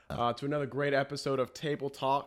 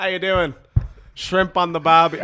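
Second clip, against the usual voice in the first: imitating a bad Australian accent, raised and loud; 5 semitones higher, 9 dB louder than usual. Host mimics people trying Australian, mock Australian accent. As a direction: do a hammy amateur Australian accent. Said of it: "trying to do an Australian".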